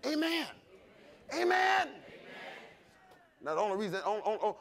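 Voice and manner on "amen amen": preacher's rising amen call. Real, not acted. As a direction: climb with each amen.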